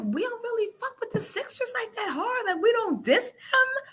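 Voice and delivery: high, laughing mock voice; mock Sixers fan rant, high and giggly. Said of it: "I'm like".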